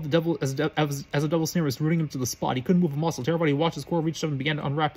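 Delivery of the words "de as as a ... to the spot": ordinary delivery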